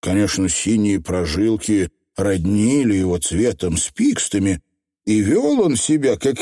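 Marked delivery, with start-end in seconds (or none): none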